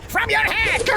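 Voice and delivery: high, menacing battle shout; furious shout. Acted. War chief threatens to behead the intruder, shouting in fury. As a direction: roar the threat at him.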